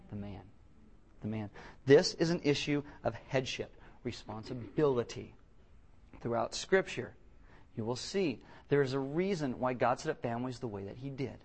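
ordinary delivery

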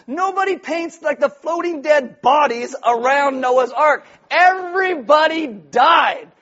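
loud, high preacher's shout, slowing down; emphatic outburst. Real, not acted. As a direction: hammer it out slowly at full volume. Punctures the cute Noah's Ark picture.

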